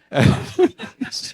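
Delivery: high breathy laugh. Real, not acted. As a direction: break into a silent, airy laugh. Laughing at his own story.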